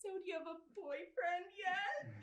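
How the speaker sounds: high-pitched mock mom voice; exaggerated high motherly prying; nosy mother. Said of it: "My mother has a way with words".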